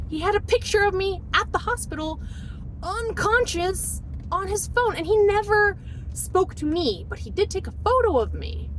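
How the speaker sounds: high, indignant disbelief; outraged. Real, not acted. Wife telling of husband's photo of her unconscious, outraged.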